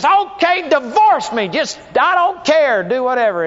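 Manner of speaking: high, loud, fed-up wife; retold wife snapping back at husband; exasperated outburst. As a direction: throw it at him in defiance. Wife in heated argument.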